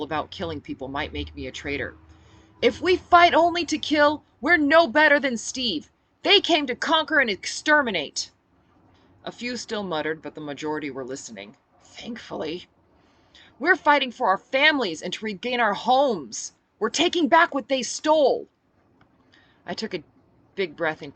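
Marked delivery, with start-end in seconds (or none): 2.6-8.3 rousing speech. Acted. Speech to calm mob. 13.6-18.5 rousing speech. Acted. Speech to crowd.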